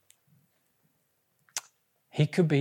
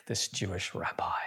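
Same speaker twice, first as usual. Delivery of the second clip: hushed whisper; 8 semitones lower, 8 dB quieter than usual. Real, not acted. Preacher's hushed question.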